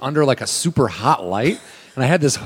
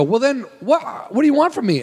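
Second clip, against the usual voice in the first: exasperated protest; 12 semitones higher than usual. Retold or imitated. Told not to sweat, high exasperated whine. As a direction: plead in helpless exasperation. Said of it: "I go".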